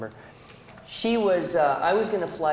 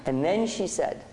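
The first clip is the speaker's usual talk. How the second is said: usual speaking voice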